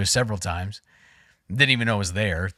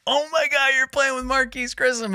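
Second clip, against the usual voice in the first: much higher, starstruck squeal; mock excited gushing; 12 semitones higher than usual. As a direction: gush like a starstruck fan. Others freaking out about Grissom.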